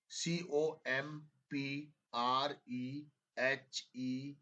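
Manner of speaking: letters spelled out slowly; slow spelling dictation. Shown as a demonstration. Teacher spelling a word slowly for students to check. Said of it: "अब check करो".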